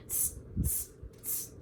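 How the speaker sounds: short voiceless "tss" noises; monkey chatter. A narrator's reading. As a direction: imitate mocking monkeys with three soft hisses. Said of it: "and said".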